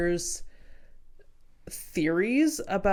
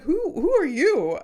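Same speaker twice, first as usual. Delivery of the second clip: guy at party; 7 semitones higher, 5 dB louder than usual. Retold or imitated. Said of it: "he's like".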